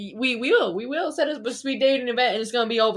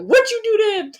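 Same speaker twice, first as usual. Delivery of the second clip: sharp, high and loud scolding; angry mom; 10 semitones higher, 8 dB louder than usual. Retold or imitated. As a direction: demand it like a furious mom. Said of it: "she got so mad at me".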